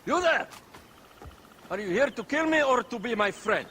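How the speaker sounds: loud high hail across the room; wary loud challenge; calling out. Acted. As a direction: shout to get a stranger's attention. Calling out to stranger.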